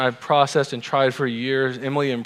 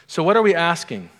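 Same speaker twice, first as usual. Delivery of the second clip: ordinary talk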